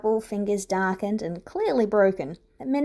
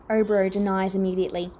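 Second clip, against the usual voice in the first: usual speaking voice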